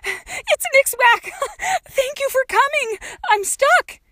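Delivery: high, loud, giddy cries through laughter; laughing like a lunatic. A narrator's reading. Trapped girl greets her rescuer, laughing wildly. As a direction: shout up with wild, laughing joy. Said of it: "she laughed like a lunatic".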